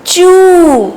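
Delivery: चू with a long, held "oo"; syllable demo. Shown as a demonstration. Teacher answering how it is said, चू drawn out. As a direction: stretch the vowel of चू. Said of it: "इसको हम क्या बोलेंगे".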